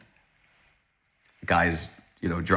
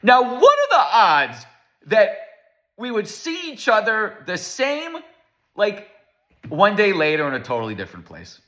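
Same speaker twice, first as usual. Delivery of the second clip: put-on amazed older man; 11 semitones higher than usual. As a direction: draw it out high like an astonished old man. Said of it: "he looks at me and he goes".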